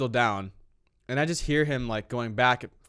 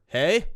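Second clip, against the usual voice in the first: hey with a high rising question tune, louder; 6 semitones higher, 5 dB louder than usual. Shown as a demonstration. Showing a weird way to greet someone walking in. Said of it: "that'd be weird".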